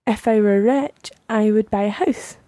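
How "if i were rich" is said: normal talking voice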